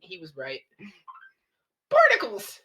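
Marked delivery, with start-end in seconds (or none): 1.9-2.6 high, loud cartoon yell. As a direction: shriek it like a cartoon sponge. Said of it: "yelled SpongeBob".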